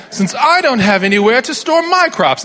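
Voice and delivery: hammed-up British toff voice; mock British accent. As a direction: put on a snooty British accent. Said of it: "they're also distinctly British".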